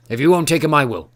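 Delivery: loud bellow, pitch high then settling. A narrator's reading. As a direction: roar it at Roran. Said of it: "bellowed Horst".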